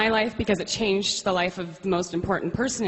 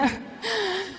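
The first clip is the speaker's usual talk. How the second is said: a high, airy laugh through tears; teary laugh; 13 semitones higher, 5 dB quieter than usual. Real, not acted. Embarrassed by her tears, teary little laugh. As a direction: laugh off the emotion, still teary.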